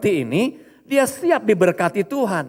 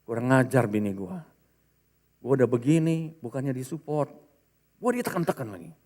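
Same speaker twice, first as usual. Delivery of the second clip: low, soft Betawi-slang complaint, much lower pitch; angry Betawi grumble; 8 semitones lower, 7 dB quieter than usual. Retold or imitated. Husband complaining about his nagging wife, low Betawi grumble. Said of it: "Ini bahasa Betawi ya".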